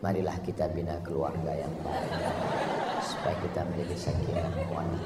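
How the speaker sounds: very soft, low, quick murmur; gentle speech demo. Shown as a demonstration. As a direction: put on a hushed, gentle voice. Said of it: "udah setel ngomong itu lembut".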